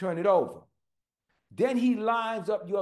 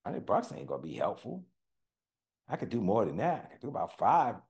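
inner voice; 10 semitones lower, 6 dB quieter than usual. Retold or imitated. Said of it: "And I was like".

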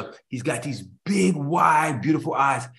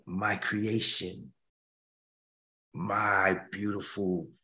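slow, much softer, sinking into a whisper; tender soft voice; 6 semitones lower, 7 dB quieter, slower than usual. Acted. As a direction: hush it like comforting a newborn. God's whisper to his creation.

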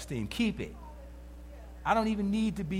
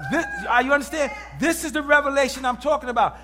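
louder, higher preacher's cry, building; full-force preaching; 4 semitones higher, 6 dB louder than usual. Acted. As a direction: preach it to the room with fire.

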